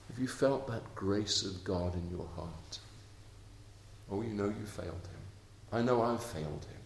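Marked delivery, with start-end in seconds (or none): none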